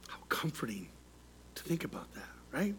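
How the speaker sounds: very soft, near whisper; hushed reflection. Real, not acted. Preacher reflecting on the good shepherd, hushed. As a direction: drop to a low, hushed murmur.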